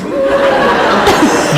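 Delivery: high burst of delighted laughter. Real, not acted. Laughs after the quote.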